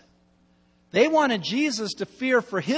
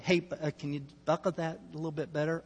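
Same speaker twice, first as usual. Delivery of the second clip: polite mild request; 4 semitones lower, 9 dB quieter, faster than usual. Retold or imitated. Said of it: "they weren't just saying".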